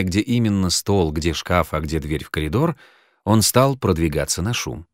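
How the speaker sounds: plain reading voice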